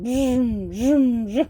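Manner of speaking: vroom noises swooping up and down; imitated whoosh. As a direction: mimic a little zooming ball. Imitates the flying training ball.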